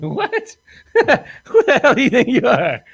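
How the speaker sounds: cracking up while playing his own outrage; laughing mock outrage. Retelling to the host how he answered her money demand, laughing mock outrage.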